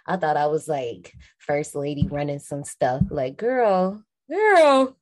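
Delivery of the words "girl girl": rising to a louder, higher second call; escalating "girl" with mock attitude; sassy mock scolding. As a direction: play a bossy young woman calling someone out. Mocking her bossy younger self.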